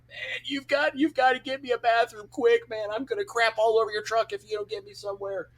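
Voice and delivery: high, desperate imitated pleading; mock panic, barely holding it in; cussing him out. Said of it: "just swearing and cussing him out".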